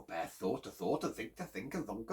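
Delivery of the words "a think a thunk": ordinary delivery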